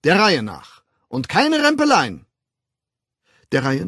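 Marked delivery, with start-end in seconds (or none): 0.0-0.8 loud, raised-pitch order; commanding. A narrator's reading. Official orders a jostling crowd. 1.1-2.3 loud, energetic orders. A narrator's reading. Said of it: "erklärte er energisch".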